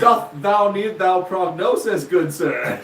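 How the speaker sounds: slow, hammed-up Old English speech; mock Shakespearean. Joking as a nurse to a patient, mock Shakespearean. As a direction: declaim it like a Shakespeare player. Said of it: "she went to Shakespearean".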